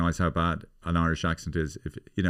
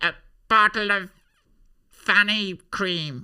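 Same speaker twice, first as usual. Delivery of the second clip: high, loud falsetto, drawn out at the end; comic character voice; 12 semitones higher, 4 dB louder, slower than usual. Acted. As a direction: squeal the request in a silly high voice.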